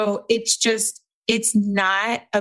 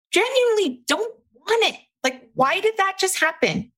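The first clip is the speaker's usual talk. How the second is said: squeaky baffled voice, much higher; bewildered high; 12 semitones higher than usual. Acted. Coach puzzled by her own snacking, high and baffled. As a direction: act out puzzled confusion at yourself.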